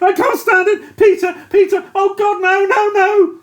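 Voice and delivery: high, loud hysterical cries; a shell-shocked man breaking down; frantic terrified outburst; panicked ranting. A narrator's reading. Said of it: "He ranted".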